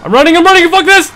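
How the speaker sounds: panicked screaming. Real, not acted. Chased by monster in horror game.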